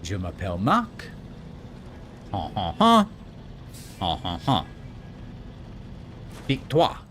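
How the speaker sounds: hammed-up French accent with mock laughs; fake French accent. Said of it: "my French accent".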